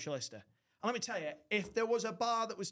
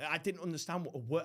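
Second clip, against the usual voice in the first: ordinary talk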